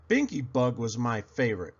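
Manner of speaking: plain reading voice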